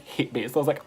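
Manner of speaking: ordinary talk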